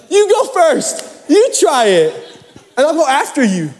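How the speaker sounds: high, drawn-out pleading; hammed-up nervous insistence; mock-scared urging. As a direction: push someone else ahead in a high voice. Acting nervous person pushing others ahead.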